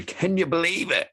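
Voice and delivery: mock disbelief.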